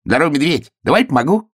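high-pitched, chirpy hare voice; lively high animal character; eager hare. A narrator's reading. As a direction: pipe up high to offer help. Hare greets bear in story.

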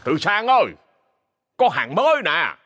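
calling from the gate with a delivery. A narrator's reading. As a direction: hail the butcher from a distance. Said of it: "tiếng người gọi".

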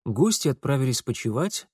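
ordinary delivery, nothing marked

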